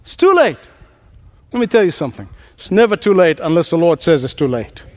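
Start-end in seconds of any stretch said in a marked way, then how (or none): 0.0-0.6 mock anguish pitched high; despairing thought. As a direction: cry out the hopeless thought high. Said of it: "How many times have you thought".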